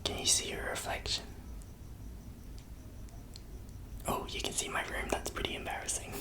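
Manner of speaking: soft unvoiced ASMR whisper; hushed ASMR murmur. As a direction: breathe it close to the mic.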